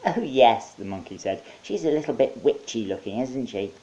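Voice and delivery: high, cheerful monkey; chirpy host monkey. Acted. Monkey welcoming Mike with potato hands.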